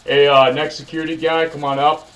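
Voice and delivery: loud call down from the balcony. Retold or imitated. Interviewer calling down to the waiting applicants. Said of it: "I look down, I'm like".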